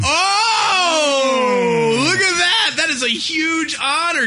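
high-pitched impressed outburst; excited. Real, not acted. Host hailing an honor for the caller, excited. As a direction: open on a long shouted oh, then marvel.

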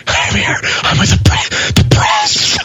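mock wheezing, gasping between words; out of breath. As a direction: play totally winded, barely getting the words out. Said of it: "Karen just out of breath".

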